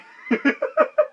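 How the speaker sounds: squeaky high laugh; high burst of laughter. Real, not acted. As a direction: laugh it off nervously. Laughs after telling crash.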